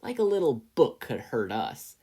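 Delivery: loud mocking jeer. A narrator's reading. Tasaki jeering at Izuku, a loud sneer. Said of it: "Tasaki jeered".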